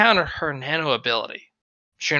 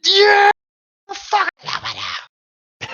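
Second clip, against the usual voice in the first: much higher, louder victory whoop; shrieking with glee at the win; triumphant yell; 7 dB louder than usual. Acted. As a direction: crow like a champion. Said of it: "and you go".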